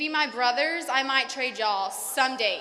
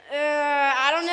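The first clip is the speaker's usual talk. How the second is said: rising, teasing I don't know, louder; playfully unsure, half laughing; mock doubtful; 3 semitones higher, 7 dB louder than usual. Real, not acted. Teasing classmate from rival school, mock doubtful. Teasing a fellow speaker onstage.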